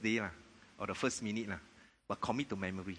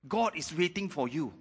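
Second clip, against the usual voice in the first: normal talking voice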